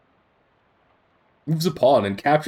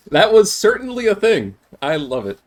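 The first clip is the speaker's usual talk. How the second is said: excited; 6 semitones higher, 5 dB louder than usual. Real, not acted. Delighted after the strange scene.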